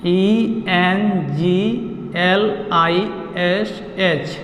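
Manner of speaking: slow letter-by-letter spelling. Shown as a demonstration. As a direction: spell each letter slowly and clearly. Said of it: "तो हम लोग पढ़ने जा रहे हैं इंग्लिश".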